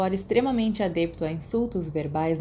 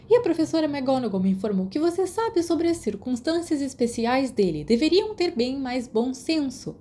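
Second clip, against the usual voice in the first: high, raised lecturing nurse voice; scolding matron; 6 semitones higher than usual. Acted. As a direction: give a stern telling-off. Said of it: "um sermão de repreensão".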